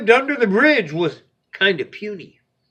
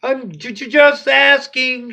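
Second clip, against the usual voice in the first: nervous stammer; stuttering; 8 semitones higher, 4 dB louder than usual. Acted. Little Ogre stammers at the bully goat, scared. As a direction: trip over the first word in fear. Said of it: "stuttered Little Ogre".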